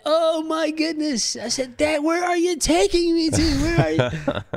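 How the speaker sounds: high, loud, bewildered cry to dad; shocked teen. Retold or imitated. Teenager asking dad where he's been taken, alarmed. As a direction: panic a little, like lost in a strange land.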